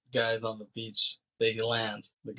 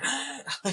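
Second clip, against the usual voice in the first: very high, weird animal cry; imitated tiger sound. Richard startling the fleeing girl, imitated tiger cry. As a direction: let out a strange high tiger noise. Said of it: "he does this weird tiger sound".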